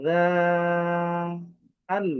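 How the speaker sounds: "রাল" with a buzzed start and very long vowel; long-drawn letter name. Shown as a demonstration. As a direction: recite "রাল" on one sustained, chant-like note. Teacher recites letter name, drawn out.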